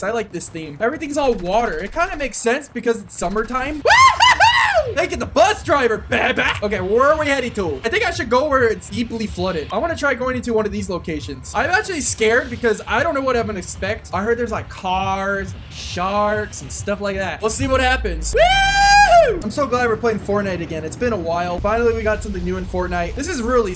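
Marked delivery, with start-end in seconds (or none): 3.8-4.9 high, loud, drawn-out woo-hoo-hoo; gleeful high shout; long whooping cheer. Real, not acted. Cheering while jumping from bus. 18.3-19.4 high, loud "woo"; gleeful high shout; whooping cheer. Real, not acted. Cheering while dropping into game.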